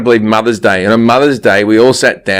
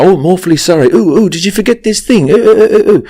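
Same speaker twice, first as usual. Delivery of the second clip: higher-pitched, fluttery awkward Brit; bumbling British guy; 5 semitones higher, faster than usual. Acted. British guy meets a woman in a romance, bumbling. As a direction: bumble apologetically, voice lifted high. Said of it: "some woman who meets a British guy".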